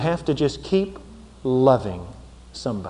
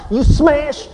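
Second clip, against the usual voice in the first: triumphant shout; 6 semitones higher, much slower than usual. Retold or imitated. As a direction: yell it like you just won the point. Acting out a winning smash.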